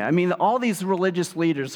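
usual speaking voice

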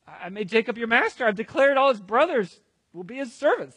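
loud, high, acted distress; upset father explaining forcefully; agitated declaration. Isaac answers in acted quarrel.

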